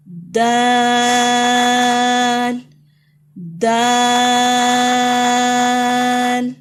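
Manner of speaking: دال very slow and loud, the long aa stretched; drawn-out letter. Shown as a demonstration. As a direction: stretch دال slowly at full voice. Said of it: "اونچی آواز سے پڑھیں".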